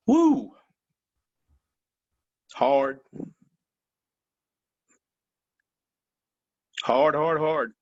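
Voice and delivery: fighting back tears over a hard memory; choked up. Real, not acted. Recalling leaving his team, getting emotional and choked up. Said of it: "sorry I got a little emotional".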